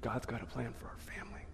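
soft whispered words from grandpa. Retold or imitated. Grandpa whispers to the boy about God's plan. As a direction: hush it like a family secret. Grandpa voiced in childhood memory.

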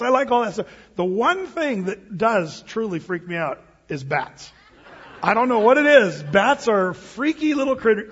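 usual speaking voice

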